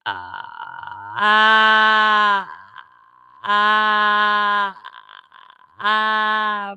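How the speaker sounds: from creaky fry swelling to a full loud ah. Shown as a demonstration. Vocal coach demoing fry opening to full voice. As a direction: open the fry into a full ringing ah. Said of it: "Let's go from the fry to the full sound".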